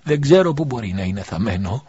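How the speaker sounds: plain reading voice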